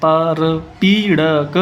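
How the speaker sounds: परपीड़क stretched syllable by syllable, very slowly; teacher dictating परपीड़क slowly; slow spelled-out reading. Shown as a demonstration. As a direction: sound out परपीड़क slowly for the class. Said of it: "परपीड़क क्या शब्द है जी?".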